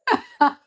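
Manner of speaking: a short high laugh breaking through. Real, not acted. She laughs at her own line, a bright laugh. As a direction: laugh at the joke.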